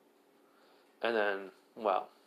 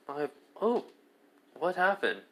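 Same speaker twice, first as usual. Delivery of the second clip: feigned surprise, pitched up; a teasing fake-innocent gasp; mock innocent; 6 semitones higher than usual. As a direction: play dumb, all innocence. Issei feigns innocence at the cut.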